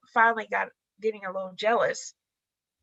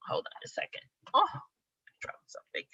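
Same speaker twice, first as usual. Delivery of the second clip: hushed quick aside; whispered aside; 7 semitones higher, 8 dB quieter than usual. Real, not acted. Vlogger pausing after dropping something, whispering. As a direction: whisper it off to the side. Aside while recording video.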